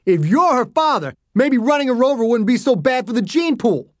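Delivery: high, strained angry jibe; scathing insult. A narrator's reading. Driver to the father mid-quarrel, scathing. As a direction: sneer an insult in the heat of a row.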